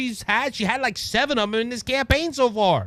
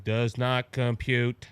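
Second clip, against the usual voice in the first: low, slow monotone robot; mechanical, evenly spaced monotone; robot Kamala Harris; 9 semitones lower, 4 dB quieter, much slower than usual. Retold or imitated. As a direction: drone it like a machine erroring out. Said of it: "sounded like she's on a robot".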